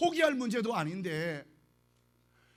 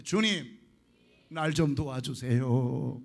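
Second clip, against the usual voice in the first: the prayer said out slowly and a bit louder; 9 semitones lower, 3 dB louder, slower than usual. Shown as a demonstration. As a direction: cry it out slow so all can join. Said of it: "크게 하세요". (느리고 크게 외치는 기도)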